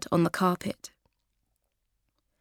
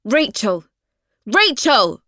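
loud, higher angry calls; calling out; 4 semitones higher, 8 dB louder, slower than usual. Acted. Kathy calling for Rachel across the flat, loud and angry. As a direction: shout her name down the hall.

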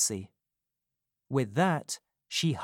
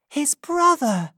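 much higher and louder, astonished; astonished cry; 9 dB louder than usual. A narrator's reading. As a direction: cry out in disbelief. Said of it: "cried Rose".

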